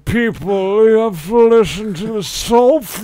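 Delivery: slow, grand put-on voice of a self-important gentleman; Sir Hoovington voice. Friend pretends to announce the podcast as a slow, lordly Sir Hoovington. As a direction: drawl it like a lord addressing his little people. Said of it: "say it as Sir Hoovington would".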